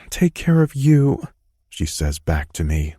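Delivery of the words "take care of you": mumbled woman's reply. A narrator's reading. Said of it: "mumbling into my shoulder".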